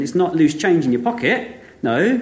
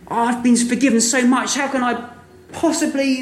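lightly voiced, raised and wondering; a hint of a humbled servant; moved servant; 7 semitones higher than usual. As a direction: marvel at the mercy received.

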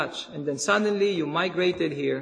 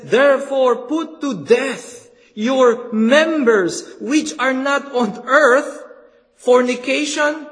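loud, slow, insistent sermon; fervent preacher driving it home; preaching at full force; 5 semitones higher, 6 dB louder than usual. Acted. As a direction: thunder the call to put sin to death. Reads scripture, urges mortification.

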